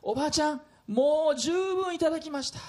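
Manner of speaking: a hint of a kindly driver; bus driver voice. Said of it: "運転手は…こう言ったんです". (少し高めの運転手の声)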